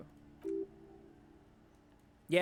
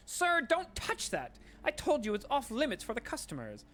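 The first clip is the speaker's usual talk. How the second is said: a jittery shop lady begging you to stop; panicked shopkeeper. Acted. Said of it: "That's how she talks".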